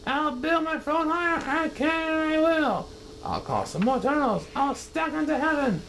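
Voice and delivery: high, boastful resolve; jealous king. Acted. Yertle jealous of the moon, high and boastful.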